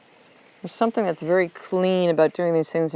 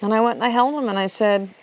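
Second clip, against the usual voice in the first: ordinary delivery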